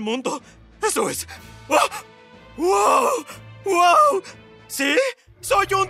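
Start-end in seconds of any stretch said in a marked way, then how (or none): 1.7-4.2 excited. Acted. Hatched as a dinosaur.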